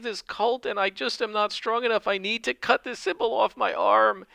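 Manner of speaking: high, tearful pleading woman's voice; sobbing cult woman. Acted. Fake cultist woman begging Wiglaf to cut off her brand, high tearful plea. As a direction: beg through fake tears, pitched high. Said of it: "she's sobbing and crying".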